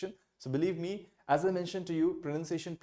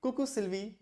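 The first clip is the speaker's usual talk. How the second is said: Coucou Sylvie pitched higher, playful; casual greeting; 8 semitones higher, much slower than usual. Pretend. Greeting friend Sylvie, playful and higher. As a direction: call a light hello to a friend. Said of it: "you can say".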